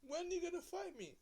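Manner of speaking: high squeaky mock taunt. Kid retelling Bayley's challenge to the champion, high mock taunt. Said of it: "Bayley says to Bianca Belair".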